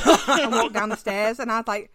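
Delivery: normal talking voice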